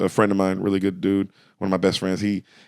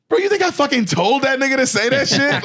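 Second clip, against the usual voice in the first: pitched-up, exasperated disbelief; mock incredulous protest; 14 semitones higher than usual. To Dave Chappelle, insisting he didn't set it up, high incredulous protest. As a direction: protest high, as if he's blaming you.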